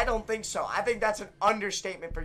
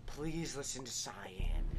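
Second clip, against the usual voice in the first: breathless whispered begging; whispered plea; 7 semitones lower, 13 dB quieter than usual. Real, not acted. Gamer whispering to teammates, please listen, desperate.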